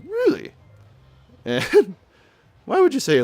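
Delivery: high-pitched mock questioning really; acted reaction. Reading out the girl's reply option, mock high-pitched. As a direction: squeak out a quick, high option. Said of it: "the right is like".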